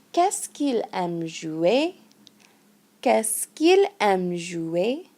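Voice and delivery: slowed "Qu'est-ce qu'il aime jouer", every syllable clear; slow model phrase. Shown as a demonstration. Teacher repeats the example for students, slowed down. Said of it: "And that sounds like".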